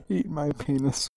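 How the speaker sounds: real laughter breaking through the misread line; losing it mid-sentence, voice fading into laughs; cracking up. Real, not acted. Reader stumbles over the story and breaks up laughing.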